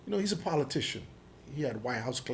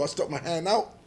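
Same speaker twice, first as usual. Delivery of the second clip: ordinary delivery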